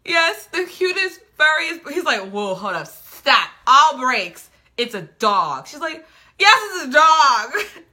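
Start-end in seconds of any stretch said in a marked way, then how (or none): 0.0-1.8 high, loud, slow doting; mock gushing. Heroine describing her baby Hugo, exaggerated doting. 2.3-5.7 mock disbelief. Guy halting her in disbelief. 6.4-7.8 high, loud, drawn-out retort; mock indignant yell. Heroine defending her dog, exaggerated indignant shout.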